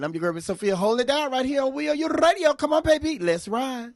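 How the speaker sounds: high, loud DJ hype; radio host sign-on. Acted. Host signing back on air, loud DJ hype.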